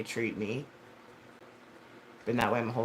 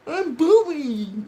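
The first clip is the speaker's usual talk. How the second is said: high, drawn-out mock whine; put-on babyish voice, very slow; mocking childish voice; 13 semitones higher, 5 dB louder, much slower than usual. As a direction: stretch it out high like a little kid. Mocking a childish-sounding term.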